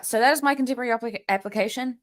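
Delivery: ordinary delivery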